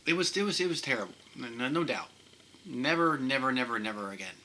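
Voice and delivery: high, choked-up real emotion; emotional, losing composure. Real, not acted. Patient recalling a terrible procedure, emotional and high-pitched. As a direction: struggle to regain composure, pitch jumping high. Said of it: "Let me regain my composure".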